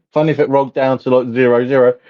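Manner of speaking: ordinary talk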